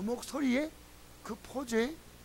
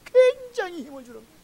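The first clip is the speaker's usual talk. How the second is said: excited; 11 semitones higher, 10 dB louder than usual. Real, not acted. Excited about encouragement.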